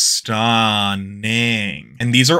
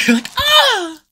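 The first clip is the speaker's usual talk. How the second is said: high squealing cries of delight, much higher and louder; excited squeal; 6 dB louder, much slower than usual. Real, not acted. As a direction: squeal over the new pin. Unboxing her own pin design.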